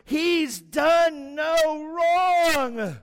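very high, very slow shout; Pilate pleading. Acted. Pilate shouting to the crowd, high and slow. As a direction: cry it out over the mob. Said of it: "he goes".

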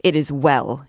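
terse, mildly stammering anger; stifled rage. A narrator's reading. Insulted captain to his accuser, stifled by rage. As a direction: force it out through held-back rage. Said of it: "in a voice stifled by rage".

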